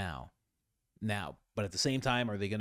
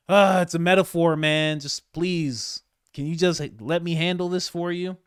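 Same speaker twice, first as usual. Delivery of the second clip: exasperated Trump; 6 semitones higher, 6 dB louder than usual. Retold or imitated. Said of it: "It's like".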